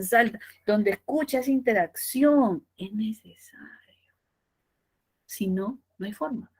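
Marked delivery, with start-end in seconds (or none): 2.8-4.1 slow whispered emphasis. Shown as a demonstration. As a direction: whisper it slowly to drive it home. Susurro enfático. (susurro lento y enfático)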